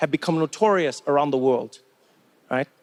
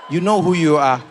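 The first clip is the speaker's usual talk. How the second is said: louder, pointed; calling out; 3 semitones lower, 4 dB louder than usual. Real, not acted. Comedian calling out the audience, louder. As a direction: call them out, emphatic. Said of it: "calling out the fans".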